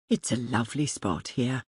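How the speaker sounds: a hint of a quiet young man, kind; young man, gentle. A narrator's reading. Young man's lines in the story.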